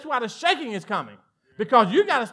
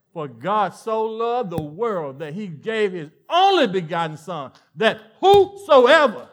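slow and weighty, swelling louder on "whosoever"; slow scripture emphasis; 6 dB louder, slower than usual. Acted. Preacher reciting John three sixteen to the congregation, slow and swelling. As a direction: lean hard on every word of the verse.

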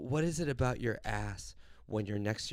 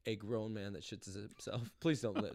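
soft, lower private plea; pleading prayer; 5 semitones lower, 10 dB quieter than usual. Retold or imitated. Telling God please don't let me, quiet pleading. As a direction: quietly beg God, lightly acted.

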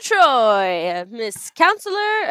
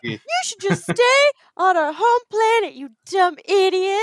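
bratty daughter; 7 semitones higher than usual. Acted. Daughter yelling at her mother.

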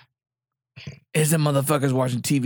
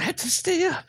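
mock high voice. Mocking actress line.

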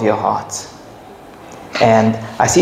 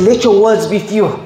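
preaching; 8 semitones higher than usual. Acted. Preaching quoted warning.

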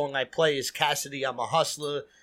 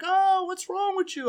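much higher, squeaky mock offense; mock indignant girls; 14 semitones higher than usual. Imitating the girls after he pulls the power, a high mocking whine. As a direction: parody the girls' whiny outrage, pitched way up.

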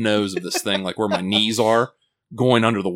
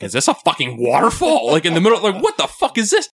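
startled, half-laughing alarm; alarmed disbelief; 8 semitones higher, 4 dB louder than usual. Retold or imitated. To his friends, reliving spotting the waterfall, alarmed and laughing. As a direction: voice climbing higher in retold panic, a laugh breaking in. Retelling panic at a hidden waterfall.

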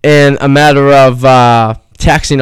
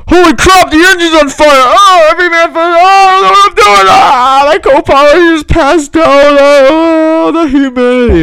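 high-pitched screaming panic, hammed up; panicking pilot; 5 dB louder than usual. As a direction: shriek the cabin announcement in mock terror, far above usual pitch. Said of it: "he won't be like".

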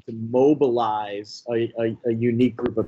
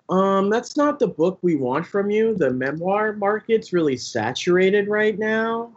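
higher, prim corporate publisher voice; 6 semitones higher than usual. Retold or imitated.

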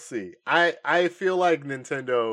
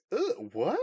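baffled question, pitched up; acted confusion; 8 semitones higher than usual. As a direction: play a fan thrown by the news. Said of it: "Visible confusion".

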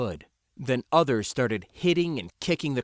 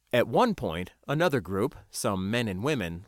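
ordinary delivery

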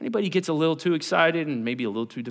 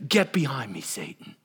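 firm rebuke; 6 semitones lower than usual. Acted. Jesus confronts his disciple before the others, firm rebuke. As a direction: rebuke firmly, sinking to a low close. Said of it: "he gets real confrontational".

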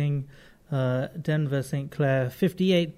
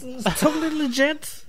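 laughing insistence; 10 semitones higher than usual. Real, not acted.

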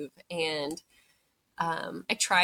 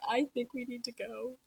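small, high, softened voice; mildly upset, quietly high; retold tearful urging; 7 semitones higher, 6 dB quieter than usual. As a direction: imitate a tearful admission, pitched up and soft. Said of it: "now I'm crying".

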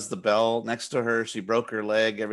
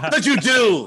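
normal talking voice

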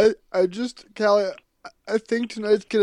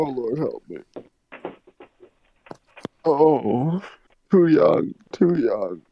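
hammed-up lament, dropping lower; mock wailing; 4 semitones lower, slower than usual. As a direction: wail to the Lord like a stage mourner.

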